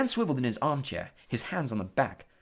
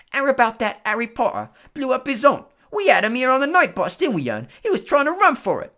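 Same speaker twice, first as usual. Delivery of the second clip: much higher, loud boastful Cockney lad; bragging conductor; 12 semitones higher, 10 dB louder than usual. Acted. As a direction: brag to the passengers. Stan imagined bragging to passengers.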